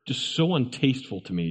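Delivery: ordinary delivery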